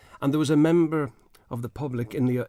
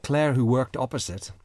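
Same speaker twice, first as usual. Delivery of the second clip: normal talking voice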